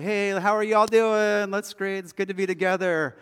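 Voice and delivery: lightly voiced upbeat small-group host; cheery greeting. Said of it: "we say".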